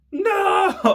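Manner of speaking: very high, loud wail; mock protest wail. Hungry recruit protests losing breakfast, high mock wail.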